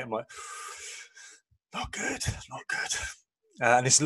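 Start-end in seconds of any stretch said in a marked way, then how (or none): 1.7-3.0 whispered rasp, voice gone from drinking; barely voiced, worn-out croak; hungover croak. Hungover reply to "how's your head.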